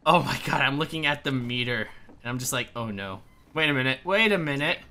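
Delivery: normal talking voice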